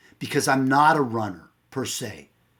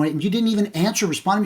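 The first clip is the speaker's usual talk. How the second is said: raised-pitch, hurried retold accusation; aggrieved complaint; 9 semitones higher, much faster than usual. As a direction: replay a husband's indignant complaint. Acted quarrel with wife.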